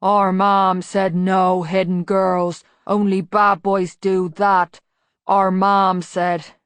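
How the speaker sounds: slow, loud, lumbering big troll-man voice; a hulking simpleton, slow and loud; deep voice. A narrator's reading. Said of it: "said a deep voice".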